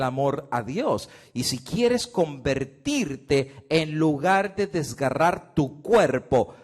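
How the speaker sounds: predica fuerte y agudo, alargando las palabras clave; predicación a todo pulmón. Acted. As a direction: clama al público como en el púlpito. Predica con fuerza. (predicación fervorosa y mucho más fuerte)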